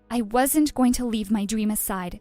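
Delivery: ordinary delivery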